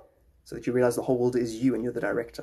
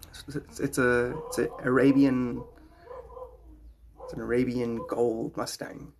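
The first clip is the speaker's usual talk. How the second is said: ordinary delivery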